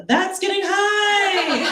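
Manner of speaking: high, loud, slow laughing outburst; excited shout. Retold or imitated. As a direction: exclaim in gleeful disbelief. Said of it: "I was like".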